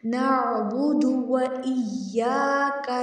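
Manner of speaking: slow, drawn-out tajweed recitation; Quran recitation. Shown as a demonstration. Teacher reciting slowly for the class. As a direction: recite each word slowly and clearly.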